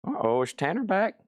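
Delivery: a hint of eager dog voice, lifting in pitch; lightly voiced hopeful dog. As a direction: lightly mimic a dog perking up at the door. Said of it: "He hears the door chime".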